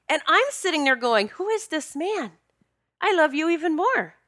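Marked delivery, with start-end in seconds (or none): 1.3-4.1 high-pitched retold wonder; amazed admiration. Woman marvelling at her outspoken soulmate, amazed admiration. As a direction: gush in astonishment at your partner.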